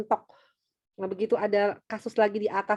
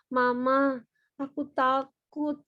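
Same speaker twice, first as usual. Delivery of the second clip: put-on slow, higher-pitched whimpering child; scared child; 3 semitones higher, much slower than usual. Child telling mama about being scared, plaintive. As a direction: drag it out like a small child confessing fear. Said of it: "Jadi kalau dia bilang".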